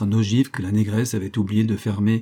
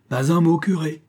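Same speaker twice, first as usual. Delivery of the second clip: higher, loud warning; menacing order; 7 semitones higher, 4 dB louder than usual. A narrator's reading. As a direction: silence him, fiercely. Threatening the terrified muezzin.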